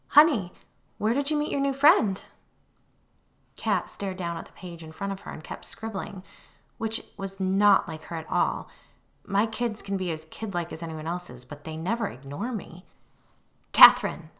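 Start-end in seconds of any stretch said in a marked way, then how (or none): 0.1-2.2 high, loud, over-sweet lilt; sing-song it to the child, forcing the cheer; unnatural sing-song. A narrator's reading. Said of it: "in an unnaturally sing-song voice". 13.7-14.3 loud, stern full-name summons; serious mom voice. A narrator's reading. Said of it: "in my most serious mom voice".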